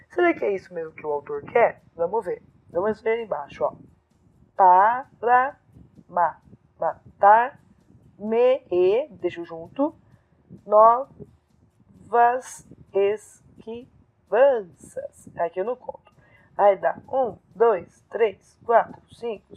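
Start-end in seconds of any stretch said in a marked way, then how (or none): 4.5-9.1 verse broken into separate syllables; syllable-by-syllable scansion. Shown as a demonstration. Teacher counting poetic syllables, broken-up reading. 10.7-15.3 very slow, separated syllables; syllable-by-syllable scansion. Shown as a demonstration. Teacher counting poetic syllables, slow broken-up reading.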